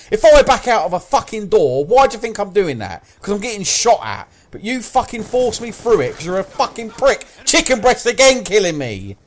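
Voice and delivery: heated, aggrieved shouting; angry rant. Real, not acted. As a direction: rant furiously at your teammate. Ranting at teammate.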